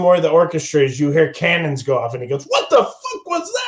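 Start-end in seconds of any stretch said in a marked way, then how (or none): 2.4-3.6 high, shocked what the fuck; startled outburst. Acted. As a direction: jump out of your skin. Actor startled by cannons.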